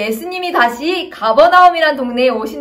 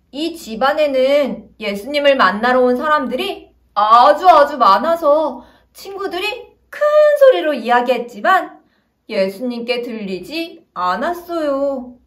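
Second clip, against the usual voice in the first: ordinary delivery, nothing marked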